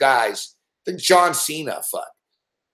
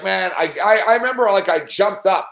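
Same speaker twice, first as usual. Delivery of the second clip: ordinary delivery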